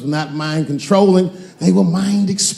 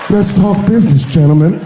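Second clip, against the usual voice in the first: menacing louder boss; 6 dB louder than usual. Retold or imitated. Menacing boardroom line.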